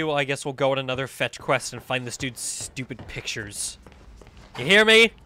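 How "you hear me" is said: loud, raised playful defiance; mock indignant shout. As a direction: call it out in mock annoyance. Calls back at the game character.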